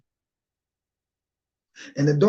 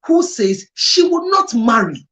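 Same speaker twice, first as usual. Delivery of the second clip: much louder and higher, pressing hard; impassioned; 6 semitones higher, 9 dB louder than usual. Real, not acted. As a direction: pour out the frustration loudly. Emotional outburst about the woman.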